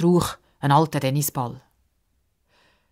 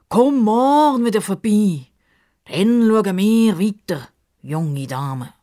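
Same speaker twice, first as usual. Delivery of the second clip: Justus voice; 5 semitones higher, 4 dB louder, slower than usual. Acted. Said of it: "sagt der Justus".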